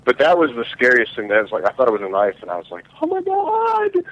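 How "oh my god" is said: very high, drawn-out mock scream; retold panicked shriek, way up high; little-girl scream. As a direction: squeal it in a stretched falsetto. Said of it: "I screamed like a little girl".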